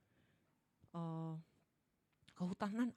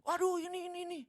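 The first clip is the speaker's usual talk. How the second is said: panicked staff; 10 semitones higher, 7 dB louder, faster than usual. Acted.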